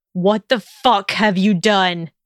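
slow, forceful, heated question; slow angry outburst; angry demand. A narrator's reading. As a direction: demand it angrily, weighting each word. Draco rounds on her angrily.

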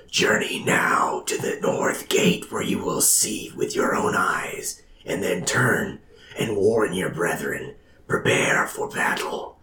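breathy divine whisper; dramatic whisper. Acted. God speaks into a man's mind, dramatic whisper. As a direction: whisper it like a voice from the gods. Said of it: "a dramatic voice".

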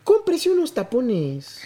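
lento, aguda, con burla seca; suéltalo despacio como remate; sarcasmo. Retold or imitated. (la operadora respondiendo al vecino, sarcástica)

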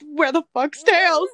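loud, slow panicked yell; freaked-out yell. Retold or imitated. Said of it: "Sonic also freaks out and is like".

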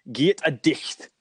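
slow broad Doric Scots, slowly. Retold or imitated. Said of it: "like, northeast Doric".

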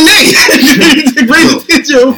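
high, loud protest through laughter; laughing disbelief. Retold or imitated. Acts out his disbelief, laughing. (lachend, vol ongeloof)

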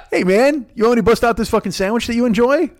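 high-pitched offer to a buddy; lightly acted chummy host; mock eager host. Acts out host offering old food.

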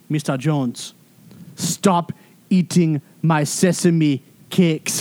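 a mock-stern Congolese accent, slow and emphatic. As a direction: lean into the Congolese accent, stretch every word. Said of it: "trying to put on this Congolese accent".

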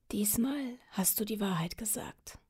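soft, faint whisper; dying whisper. Acted. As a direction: whisper it gently, almost gone. Dying king speaks last words.

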